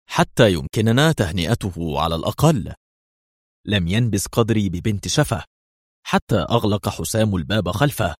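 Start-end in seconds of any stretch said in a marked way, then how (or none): none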